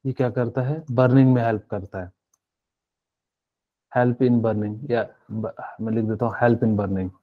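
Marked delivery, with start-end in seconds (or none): none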